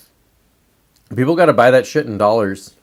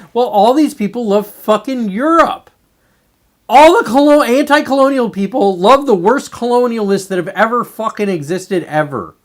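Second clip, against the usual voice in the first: louder and higher, fired-up rant; heated rant; 9 semitones higher, 6 dB louder than usual. Real, not acted. Vlogger ranting at anti-colonial people, loud and heated. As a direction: blast it out in indignation.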